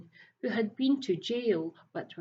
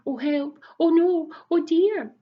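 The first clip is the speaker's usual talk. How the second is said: 1 alarmed cries; 8 semitones higher, 8 dB louder than usual. A narrator's reading. Dismay at vanishing eggs.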